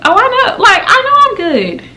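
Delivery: sweet on top, annoyed underneath; sarcastic reply. Retold or imitated. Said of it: "And I'm just like".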